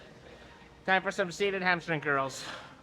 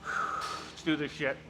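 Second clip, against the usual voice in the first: psyched-up mutter; 4 semitones lower, 7 dB quieter, much slower than usual. Real, not acted. Psyching up before the set.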